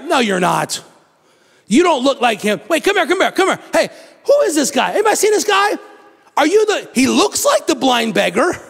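skeptical neighbors. Retold or imitated. Townsfolk doubting healed beggar.

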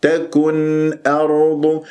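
slow chant, rounded u in تكن; rounded lips. Shown as a demonstration. Teacher shows rounded lips on تكن, slow recitation. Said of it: "نضم الشفاه فيها".

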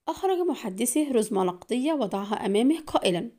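plain reading voice

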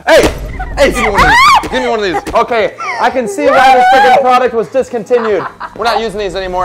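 yelling through laughter; laughing shout. Real, not acted. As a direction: shout it while cracking up. Hit by soccer boppers.

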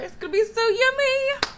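showing off her yogurt bowl, gushing; excited. Real, not acted. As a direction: squeal it like a treat. Showing breakfast to camera.

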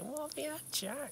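high imitating voice. Mimicking old times at dinner.

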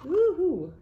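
high loud woo-hoo; cheer. Acted. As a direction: whoop with joy. Throws hat in air.

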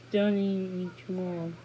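ordinary delivery, nothing marked

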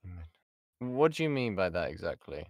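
ordinary delivery

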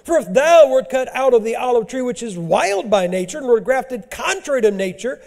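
loud, high scripture preaching; thundering sermon reading; full-force preaching. Real, not acted. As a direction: drive the verses home.

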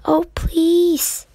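put-on high, loud childish plea; little sister pleading, high and loud. A narrator's reading. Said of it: "Amy pleaded".

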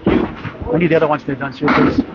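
normal talking voice

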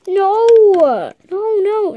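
loud high cry of protest; big dismayed shout. Acted. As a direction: yell it out in alarm. Panicked protest in toy scene.

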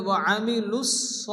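আমিলুস স with the thick s held across both words; doubled sad. Shown as a demonstration. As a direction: join lus into a heavy so in আমিলুস স. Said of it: "দুবার উচ্চারণ করে".

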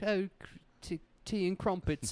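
a light hammed-up English toff; mock English accent. Doing Dracula's English side, a mock English accent. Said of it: "the English".

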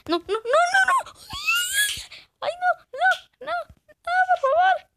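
high, loud panicked cries of no; panicked screaming. Real, not acted. Gamer being attacked in-game, panicked screaming. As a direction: scream and beg in terror.

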